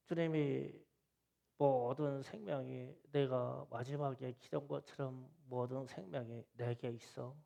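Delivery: usual speaking voice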